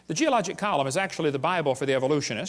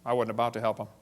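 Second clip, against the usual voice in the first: usual speaking voice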